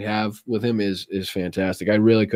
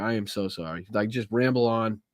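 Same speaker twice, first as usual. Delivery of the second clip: ordinary talk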